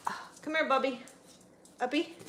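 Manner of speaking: high coaxing call to the dog; pet talk. Real, not acted. Owner calling her dog onto her lap, high coaxing voice. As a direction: coax him up in a high voice.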